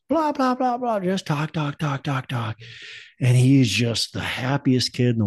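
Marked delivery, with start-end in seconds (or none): 0.1-2.6 put-on chattering kid. Imitating son's morning chatter.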